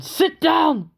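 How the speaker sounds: high, loud shouted order; bellow. A narrator's reading. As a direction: bark it like an angry inspector. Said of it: "bellowed Foster".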